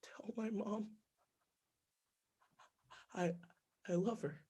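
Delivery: soft unvoiced whisper. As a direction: breathe out a dying plea. Beaten man's last words.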